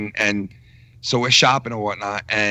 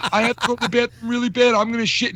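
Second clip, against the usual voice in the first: desperate thief; 10 semitones higher, faster than usual. Retold or imitated. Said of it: "The guy was going".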